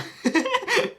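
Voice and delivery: shrill giggle burst; high squealing laugh. Real, not acted. Laughs at own joke.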